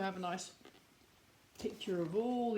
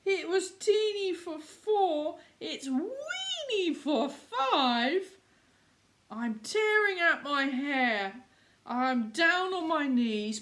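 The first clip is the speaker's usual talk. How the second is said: put-on high, wailing old lady voice; distressed old lady; 8 semitones higher, 5 dB louder than usual. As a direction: cry it out like a frantic old lady. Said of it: "The little old lady cried".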